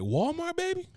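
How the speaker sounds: incredulous. Real, not acted. Mocking incredulity at Walmart.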